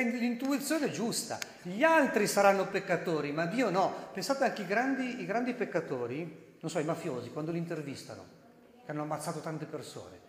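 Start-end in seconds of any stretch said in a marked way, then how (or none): none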